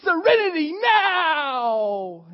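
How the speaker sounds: slow, huge, high shout; a mocking scream of forced calm; shouted serenity now. Shown as a demonstration. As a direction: yell it out to the rafters. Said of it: "everybody stand up with your hands and go".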